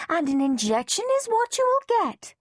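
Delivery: high, firm lecture; stern nurse. A narrator's reading. Nurse insisting Henry gets his injection, firm. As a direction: lay down the law.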